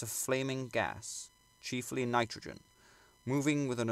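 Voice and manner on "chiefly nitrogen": ordinary delivery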